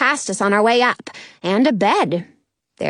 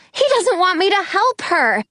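ordinary delivery

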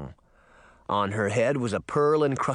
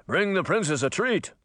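raised-pitch soldier's relay call; shouted order; 6 semitones higher than usual. A narrator's reading. Second merman soldier repeats the order, mildly shouted. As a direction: pass the order on in a higher, contained call. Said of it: "A second merman soldier shouted".

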